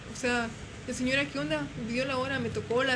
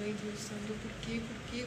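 scared murmur; 2 semitones lower, 9 dB quieter than usual. Retold or imitated. Frightened after boy vanished.

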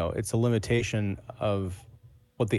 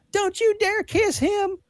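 mock Southern American accent pitched way up; overdone Southern twang; 4 dB louder, slower than usual. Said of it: "generic Southern American twang accent".

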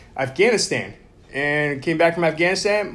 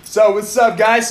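higher, louder, pumped hello to the crowd; hyped greeting; 6 semitones higher, 6 dB louder than usual. Real, not acted. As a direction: greet everyone like a hype man.

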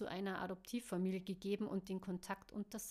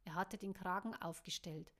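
usual speaking voice

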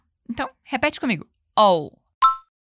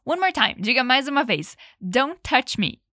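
ordinary delivery

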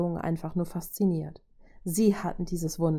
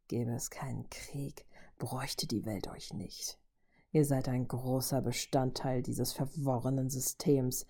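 low, soft, measured villain voice; menacing robot villain; 4 semitones lower, 5 dB quieter than usual. A narrator's reading. Robot lecturing the Avengers as hypocrites, quiet menace.

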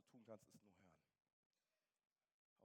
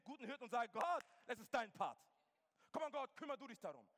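loud, high, fervent preaching to the crowd; full-force preaching; 9 semitones higher, 11 dB louder than usual. Acted. Preacher calling the church to hand fear to God, fervent and loud.

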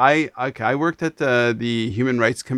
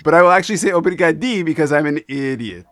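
higher, louder, quick French-Israeli put-on accent; 4 semitones higher, 5 dB louder, faster than usual. Shown as a demonstration. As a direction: joke at your own expense in a muddled French accent. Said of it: "I'm using a strange Israeli Fran-, French accent".

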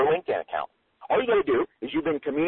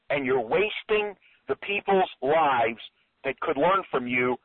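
raised, emphatic coaching voice; urgent motivational challenge, drawn out for emphasis; rousing push; 4 semitones higher, slower than usual. Shown as a demonstration. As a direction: drive each point home with stretched key words. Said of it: "you see what I'm doing here?".

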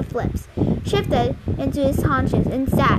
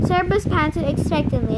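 ordinary delivery, nothing marked

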